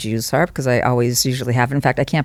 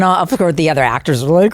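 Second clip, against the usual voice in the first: usual speaking voice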